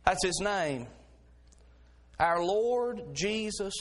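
ordinary talk

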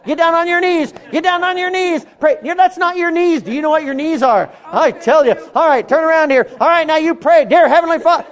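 high, loud bullying command; hammed-up pushy soul winner barking orders; pushy preacher. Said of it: "I'm not gonna say".

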